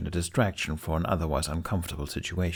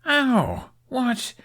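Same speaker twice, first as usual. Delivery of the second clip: high, sudden pained yelp; jolted by a smack to the head; startled yelp. Acted. Hiccup hit by the dragon's tail, high startled yelp.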